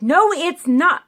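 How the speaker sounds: indignant no, slowed and loud; upset protest. Retold or imitated. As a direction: push back hard, affronted. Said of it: "I literally got upset".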